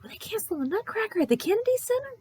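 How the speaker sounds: squeaky mock outrage at the cancellation; incredulous retort. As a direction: sound aghast at the news. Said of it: "And I was like".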